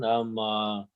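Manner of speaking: normal talking voice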